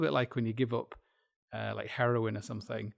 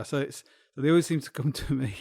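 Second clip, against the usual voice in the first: normal talking voice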